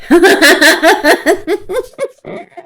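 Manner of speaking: high laugh rising up. Real, not acted. Vlogger laughs at the photo she pays for.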